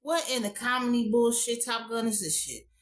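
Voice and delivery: usual speaking voice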